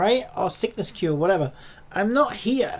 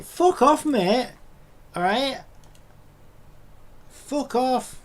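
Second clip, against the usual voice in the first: angry retort; 8 semitones higher, much slower than usual. Retold or imitated. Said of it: "It's like".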